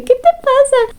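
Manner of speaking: mock mom's sharp question; mom catching a lie. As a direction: play a mother who caught her kid lying. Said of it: "mamá que te cacha mintiendo".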